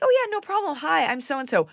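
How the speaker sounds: very high, sugary friendly voice; imagined cheery, high-pitched greeting; sweet neighbor. Retold or imitated. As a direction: chirp it like an ideal sweet neighbor. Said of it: "If she was sweet".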